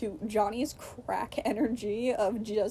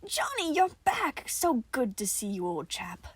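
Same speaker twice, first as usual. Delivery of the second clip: robotic cheer; 4 semitones higher than usual. Acted. Nicola greets Johnny in an uncanny robotic cheer. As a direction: greet him like a wind-up toy. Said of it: "sounding almost robotic".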